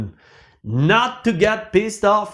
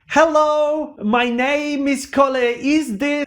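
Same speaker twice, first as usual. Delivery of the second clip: bright, eager phone greeting, pitched up; enthusiastic hello; 8 semitones higher than usual. Retold or imitated. Caller greeting an official with eager enthusiasm. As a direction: sound thrilled to be calling. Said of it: "you're full of enthusiasm".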